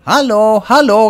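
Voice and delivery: high, loud bossy call; stern woman shouting for attention; strict cookery teacher calling. A narrator's reading. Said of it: "Ze was wel streng".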